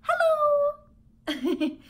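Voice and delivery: high hamster-squeak hello; squeaky hello. Acted. Humphrey greets Mrs. Nasiri, squeaky hello. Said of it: "I squeaked".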